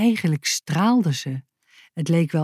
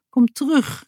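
higher-pitched call; 7 semitones higher than usual. A narrator's reading. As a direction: call after someone leaving. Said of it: "riep hij".

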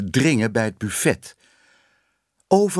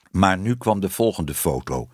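usual speaking voice

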